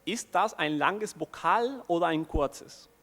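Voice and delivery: slow, deliberate German question. Acted. Lecturer posing a hypothetical exam question to students, slowly in German. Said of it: "En alemán".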